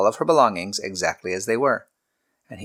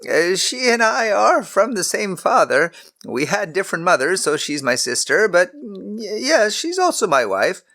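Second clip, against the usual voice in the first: put-on lightly higher, hesitant old patriarch; a caught-out husband explaining; nervous Abraham; 10 semitones higher, 3 dB louder than usual. A narrator's reading. Abraham voiced by narrator.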